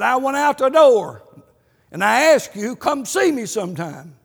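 loud, high-pitched retelling of a grudge; an old man airing his grievance, loud; aggrieved complaint. Retold or imitated. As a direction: let the old grudge boil over.

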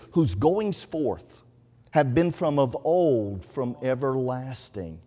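very slow, drawn-out verse; deliberate, spaced-out recitation; slow scripture quotation. Acted. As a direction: stretch out each phrase of the verse. Quotes scripture verse to rabbi.

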